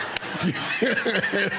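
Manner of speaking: a short real laugh; light real chuckle. Real, not acted. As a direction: laugh at the awkward picture. Laughs at his own story.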